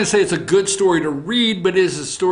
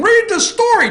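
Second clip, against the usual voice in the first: much higher and louder whiny plea; mock whiny high voice; 4 dB louder than usual. To listeners yelling at him, squeaky mock plea. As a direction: beg them in a squeaky whine. Host answers imagined angry listener.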